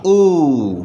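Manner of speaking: ऊ stretched long and rounded; oo sound. Shown as a demonstration. Teacher isolating the vowel for the class, drawn out. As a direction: model the vowel ऊ slowly. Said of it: "उच्चारण है वो होगा".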